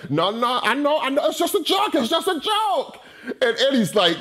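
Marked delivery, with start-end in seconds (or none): 0.1-3.0 high, put-on soothing voice; menacing fake joke. Felix calming Eddie before the attack, high false reassurance. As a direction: pretend it's only a joke. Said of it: "how menacing Gregory Sierra is".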